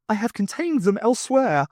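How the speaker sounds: lightly voiced alien. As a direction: state it like a strange being. Thu's alien voice.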